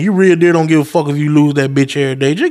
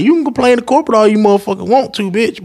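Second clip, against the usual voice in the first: fast heated retort; 6 semitones higher than usual. Retold or imitated. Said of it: "I was like".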